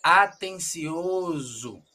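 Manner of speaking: "Atencioso" stretched very slowly with a buzzing z; slow model of "Atencioso" with a long z; drawn-out demonstration. Shown as a demonstration. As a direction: draw out each syllable of "Atencioso", voicing the s as z. Slow drilling of the z sound.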